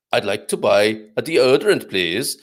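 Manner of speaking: mock Swedish customer; lilting Swedish, lifting on the last word; Swedish accent. Shown as a demonstration. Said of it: "now I do the Swedish bit".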